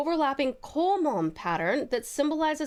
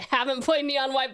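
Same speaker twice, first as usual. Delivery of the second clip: ordinary delivery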